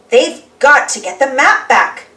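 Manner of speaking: ordinary delivery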